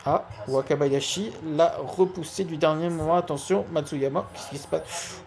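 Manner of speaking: lively sports commentator calling a match; play-by-play commentary. Acted. Live football game commentary. (commentaire sportif en direct)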